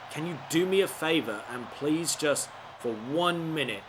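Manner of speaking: ordinary talk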